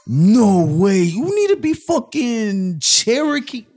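high, slow incredulous mock voice; mocking hipster friends. Mocking friends who question his dating, incredulous slow voice. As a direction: play the shocked friend, drawn out. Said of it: "And they're like".

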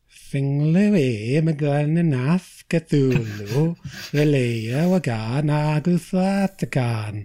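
put-on incantation read in a light Welsh accent; a playful Welsh accent on the spell. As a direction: say the spell as if it were Welsh, lilting. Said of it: "like, in a Welsh accent".

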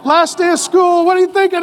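high, loud, hammed-up dad with a camera; playful shouted home-video narration; excited dad filming. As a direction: call out like a giddy dad filming his kid. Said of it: "I'm the memory dad".

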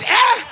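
short loud high grunt of pain; pained African eh. Retold or imitated. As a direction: yelp "Eh" like a touched sore spot. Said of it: "It just hit, it's".

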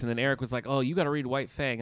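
ordinary delivery

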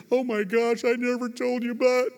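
high-pitched mock bawling; imitated tearful astonishment. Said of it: "people bawling their eyes out".

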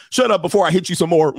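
loud parental snap, imitated; mock angry parent. Parent yelling at a crying kid, mock angry. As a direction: bark it like a fed-up parent.

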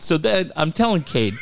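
normal talking voice

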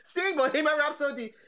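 can barely get it out for laughing. Real, not acted. Laughing at Shatner album.

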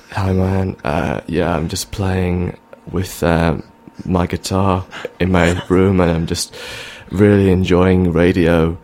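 laid-back mumbly singer chat, barely changed. Acted. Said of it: "Here's, here's [name]".

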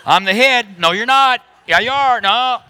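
high, loud back-and-forth, laughing; mock quarrel. Pastor acting out husband and wife arguing over who's the head, high and loud. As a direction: act out a couple bickering.

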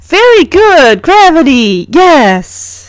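pleased teacher, starting high and easing down; congratulating teacher. A narrator's reading. Teacher congratulates Punky on the correct answer, congratulating. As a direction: praise a pupil for the right answer. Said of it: "the teacher congratulates Punky".

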